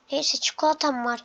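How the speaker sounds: ordinary talk, nothing marked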